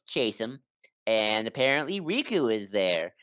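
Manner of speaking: normal talking voice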